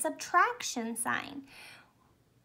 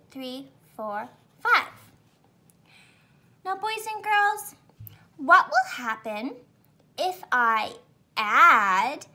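put-on kids show host; 3 semitones higher, 3 dB louder than usual. Teacher performs counting for kids.

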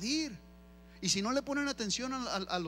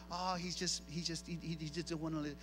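mumbled, softer parent excuse; soft excusing; 6 semitones lower, 7 dB quieter than usual. Retold or imitated. Mother excusing her child to onlookers, softly mumbled. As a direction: shrug it off softly for the kid.

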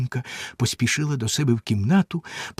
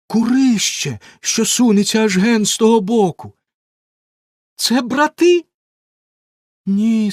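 sister's lighter voice; 9 semitones higher, 5 dB louder than usual. A narrator's reading. Sister Anna answers.

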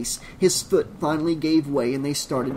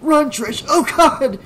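high, loud screamed warning; terrified scream; 13 semitones higher, 8 dB louder, slower than usual. A narrator's reading. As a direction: scream for her to get away. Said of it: "Rob screamed".

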